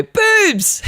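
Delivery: squeaky high cartoon cry of lust; hormone voice. Hormones shouting at a teenage boy, high squeal. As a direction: hormones yelling out like a goofy gremlin.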